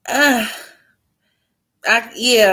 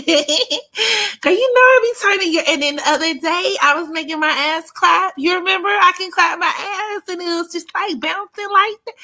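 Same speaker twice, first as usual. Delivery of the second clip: put-on high giggly girly voice, much higher; fake girly voice; 9 semitones higher than usual.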